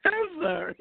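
high apology through laughter; sorry, still cracking up; laughing apology. Real, not acted. Host apologising to co-host, still laughing. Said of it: "it don't sound like you sorry".

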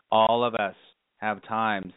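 ordinary delivery

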